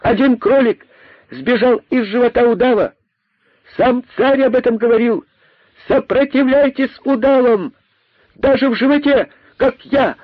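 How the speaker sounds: very high, sustained proclamation; yelling rabbit. A narrator's reading. Rabbit rallies others from the boa's belly, yelling. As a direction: cry out each phrase at top pitch. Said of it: "вопил из его живота".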